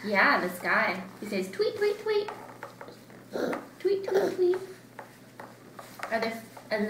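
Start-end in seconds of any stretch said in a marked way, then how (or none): 1.5-2.3 high little tweets; bird tweet. Acted. Bird sound. 3.8-4.6 high little tweets; bird tweet. Acted. Bird sound.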